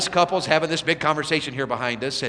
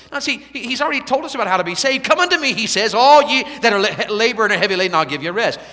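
high, loud, ringing revival preaching; full-force preaching; 9 semitones higher, 8 dB louder than usual. Acted. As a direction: drive the gospel call home to the crowd.

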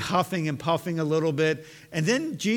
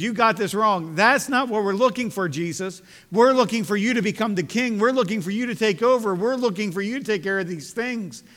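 higher and louder insistent argument; protesting Peter; 5 semitones higher, 5 dB louder than usual. Acted. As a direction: press Jesus to take over as king.

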